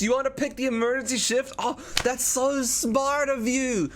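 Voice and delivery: very high, gushing fake surprise; drawn-out, hammed-up high flattery; mock amazed praise. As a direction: gush as if they thought of it. Feigns surprise, flatters chat's choice.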